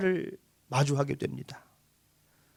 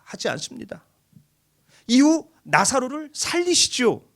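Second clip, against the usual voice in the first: ordinary talk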